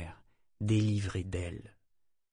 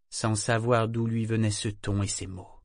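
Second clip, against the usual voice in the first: usual speaking voice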